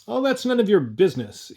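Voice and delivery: high, loud, sharp dismissal; a defiant little man snapping back; curt. Acted. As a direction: cut him off curtly. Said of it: "answered the little man in his curt voice".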